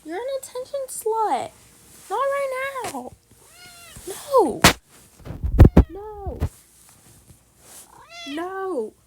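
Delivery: fed-up owner telling off her cat; scolding the cat. Real, not acted. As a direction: push the pushy cat away.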